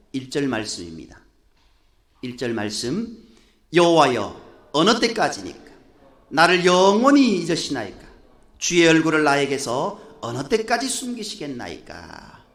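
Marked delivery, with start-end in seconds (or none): 3.7-12.4 loud, imploring scripture reading; loud crying out. Shown as a demonstration. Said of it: "아주 큰 목소리로 목청 떠나가도록".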